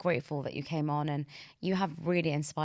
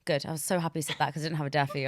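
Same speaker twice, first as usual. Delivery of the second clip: ordinary talk, nothing marked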